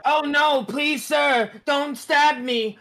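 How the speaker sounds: high, loud pleading screams; panicked yelling. Pretend. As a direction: beg for your life, shrieking. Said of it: "I start yelling out".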